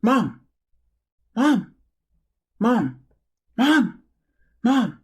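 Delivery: a hint of a small boy tugging for attention; little kid calling. Son wanting his busy mom's attention, mild mock child call. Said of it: "my kid would say".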